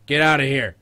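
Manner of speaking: high, very loud scolding yell; cat-shooing shout. Real, not acted. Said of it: "you sound like you're talking to a cat".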